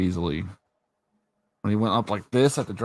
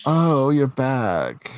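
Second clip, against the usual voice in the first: slow drawn-out mock greeting; mock bitchy; 3 semitones higher, much slower than usual. Imitating the wife greeting her husband, slow mock coldness. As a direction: stretch it out, cold and unimpressed. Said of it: "and she's like".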